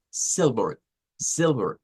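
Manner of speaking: silver with a relaxed short I, low and falling. Shown as a demonstration. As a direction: model silver with a lax first vowel, dropping in pitch. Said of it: "recordemos el color". (silver con I corta y tono descendente)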